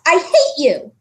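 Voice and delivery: mild hurt outburst; a touch of tearful anger; blurted. A narrator's reading. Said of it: "Susan blurted out at last".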